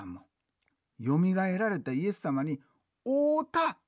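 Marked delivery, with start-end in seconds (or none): 3.1-3.8 quoted claim. Retold or imitated. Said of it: "言うてはんねや".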